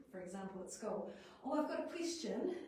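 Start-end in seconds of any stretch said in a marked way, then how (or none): 1.4-2.6 put-on high, eager child voice; child blurting out. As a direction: play an excited five-year-old.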